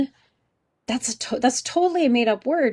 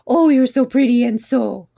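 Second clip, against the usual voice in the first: lightly imitated cooing over a baby, higher and louder; imitated family friend; 3 semitones higher, 6 dB louder than usual. Family friend talking to teacher's baby, imitated gushing. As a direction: gush like the friend admiring a baby. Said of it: "she was talking to her and she goes".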